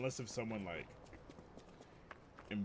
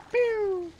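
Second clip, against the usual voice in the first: loud drawn-out "pew"; imitated rocket whistle; 10 dB louder than usual. Teller shows the firework hitting the cousin, rocket sound. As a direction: whistle like a rocket shooting past.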